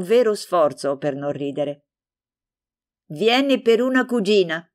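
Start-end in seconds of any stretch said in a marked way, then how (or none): 3.1-4.6 a bright, pleased exclamation, lightly voiced; glad exclamation. Franca to Stefano, glad to find a subject, lightly exclaiming. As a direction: pounce happily on a topic at last. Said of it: "esclamò, lieta di avere finalmente trovato".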